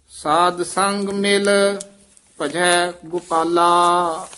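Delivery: slow measured chanting of Gurbani; scripture recitation. Acted. Teacher reciting the hymn for students to repeat, measured chant. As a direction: recite each phrase with reverent pauses.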